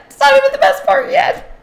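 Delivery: playful high-pitched echo; squeaky high repeat. Shown as a demonstration. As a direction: pipe it up high for fun. Repeats line much higher.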